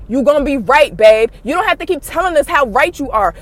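ordinary talk, nothing marked

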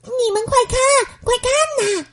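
put-on a boy calling his friends to look; excited call. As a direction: point everyone to it. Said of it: "尼克大声叫起来".

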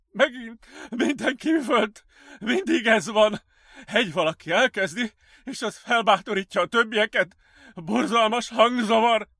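high, loud, frantic outpouring; raving patient. Acted. Patient complaining of screaming voices, frantic. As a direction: rave over a din only you hear.

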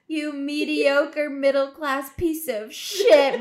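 loud, high, slow gleeful cursing; happy insult. Retold or imitated. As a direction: draw the insult out with delighted affection. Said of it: "so happy".

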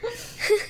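short high burst of laughter. Real, not acted. Laughing at friend's remark.

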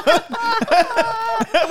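squealing high laugh; cracking up hard. Real, not acted. Friends retelling hotel ghost story, squealing laugh.

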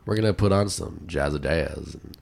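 lightly deepened smooth radio intro; deep radio host. Pretend. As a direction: croon it like a smooth podcast host. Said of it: "imagine us starting a podcast and going".

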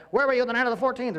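fast, high, loud cop grilling; interrogator. Retold or imitated. Pharisees interrogating the healed man, hammed-up cop. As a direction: grill him like a detective under a lamp. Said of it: "Picture, like, the lampshade shining on".

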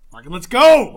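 loud, pumped-up battle cry; hyped, full-volume whoop; excited shout. Retold or imitated. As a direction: yell it like you're gunning down TIE fighters. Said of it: "and he's just like".

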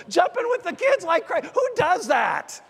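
retelling it with lifted, excited energy. Retold or imitated. Pastor recalls celebrating the winning field goal, excitedly. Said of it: "I was so excited".

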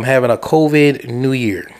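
normal talking voice

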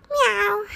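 high playful meow; cat meow. Acted. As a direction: mew like a kitten. Jen gets cats.

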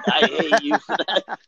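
slow, laughing through the line; laughing repeat. Retold or imitated. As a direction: crack up while repeating dad. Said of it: "He was like".

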